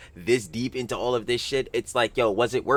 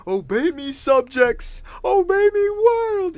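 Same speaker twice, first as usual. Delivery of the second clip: imitating anime emperor, rising high; mock imperial command, building higher; megalomaniac rant; 5 dB louder, slower than usual. Streamer repeats the anime quote.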